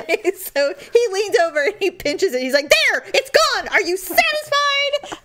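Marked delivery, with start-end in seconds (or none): 2.7-5.2 high, emphatic irritation; exasperated driver. Retold or imitated. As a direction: imitate a fed-up rescuer. Imitates annoyed driver after removing spider.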